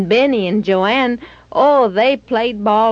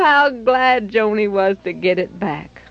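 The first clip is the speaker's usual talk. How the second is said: ordinary delivery, nothing marked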